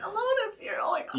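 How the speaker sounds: high squealing excitement; excited squeal. Retold or imitated. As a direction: squeal it like a starstruck fan. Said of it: "You just got so excited".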